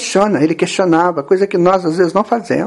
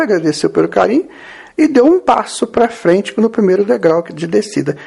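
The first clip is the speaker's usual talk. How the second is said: usual speaking voice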